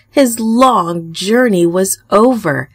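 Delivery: plain reading voice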